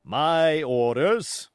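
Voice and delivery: short and clipped, slow and loud; curt. Acted. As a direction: cut him off with authority. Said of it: "said the captain shortly".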